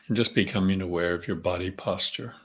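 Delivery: calm, unhurried meditation voice with long pauses; guided meditation. Real, not acted. Leader centering the group before the session, calm meditative prayer. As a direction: settle them gently into the breath, leaving space between phrases.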